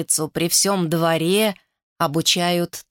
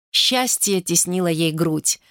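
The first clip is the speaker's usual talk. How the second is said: ordinary delivery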